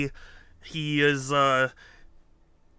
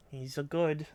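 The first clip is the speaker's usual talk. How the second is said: ordinary talk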